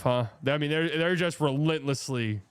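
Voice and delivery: ordinary delivery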